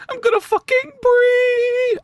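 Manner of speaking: very high, drawn-out squeal on "pre"; straining for high pitch. Shown as a demonstration. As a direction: push the voice up as high as it goes and hold it. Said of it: "my voice doesn't go that high".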